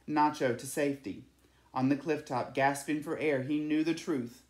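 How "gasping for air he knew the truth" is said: ordinary delivery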